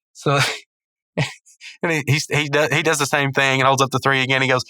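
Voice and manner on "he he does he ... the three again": ordinary delivery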